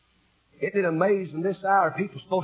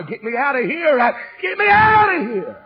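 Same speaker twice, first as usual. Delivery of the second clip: high, loud holler, rising into a shout; hammed-up lamb cry; 10 semitones higher, 11 dB louder than usual.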